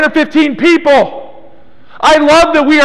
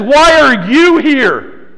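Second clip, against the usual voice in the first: slow reading with "you" stretched and emphasized; stress on you; slower than usual. Shown as a demonstration. Preacher showing how he reads the question, stress on "you". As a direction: make "you" the weighty word of the question. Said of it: "But I'm reading it like this".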